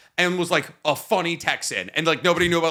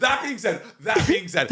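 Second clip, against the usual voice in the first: high, laughing repeat; words breaking into laughter; laughing through it; 9 semitones higher than usual. Real, not acted. Laughing at the hell joke, high.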